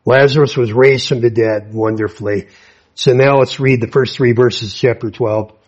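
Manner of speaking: ordinary talk, nothing marked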